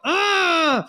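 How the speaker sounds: a sudden high, sharp frustrated grunt; monster-like grunt. Retold or imitated. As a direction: growl it out so it scares the baby. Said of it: "Dad's yelling at me. He sounds like a monster".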